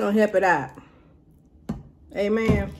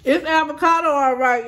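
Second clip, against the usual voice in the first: higher and louder, avocado stressed; emphatic verdict; 6 semitones higher, 3 dB louder than usual. Real, not acted. Giving viewers the verdict on the taste, emphatic. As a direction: declare it with emphasis. Tasting, then repeating a verdict.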